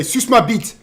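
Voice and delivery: high-pitched French demo; imitating French people. Shown as a demonstration. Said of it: "French people, they say".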